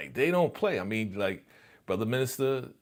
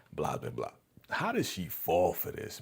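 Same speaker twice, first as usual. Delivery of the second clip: ordinary talk, nothing marked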